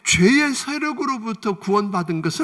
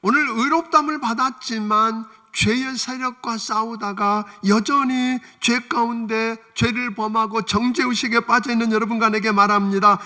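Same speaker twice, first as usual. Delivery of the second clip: ordinary talk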